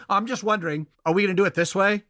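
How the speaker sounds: high, faux-polite sarcasm; sweetly barbed question; sarcastic. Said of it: "bitterness or sarcasm".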